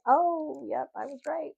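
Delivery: high-pitched drawn-out "oh" of recognition; retold knowing realization. Spotting the trauma tattoos, knowingly vindicated. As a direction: lift it high, as if suspicion is confirmed. Retelling her moment of realization.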